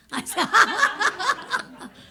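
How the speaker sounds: a sudden high burst of laughter; embarrassed, amused high-pitched laugh; high bright laugh. Real, not acted. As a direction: laugh out loud at your own slip. Laughs at own missing line.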